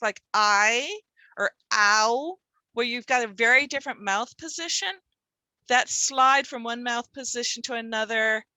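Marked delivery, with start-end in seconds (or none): 0.0-2.4 "I" and "ow" drawn out slowly; vowel demo. Shown as a demonstration. Teacher showing back-of-mouth sounds, drawn out. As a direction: stretch "I" and "ow" to show the mouth shape. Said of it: "a sound where your tongue's all the way in the back".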